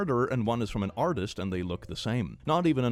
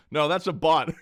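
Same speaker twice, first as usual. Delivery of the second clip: ordinary talk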